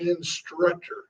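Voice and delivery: Instructor said slowly and softly, spelled out; deliberate correction. Shown as a demonstration. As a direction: drag out Instructor quietly. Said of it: "I said instructor".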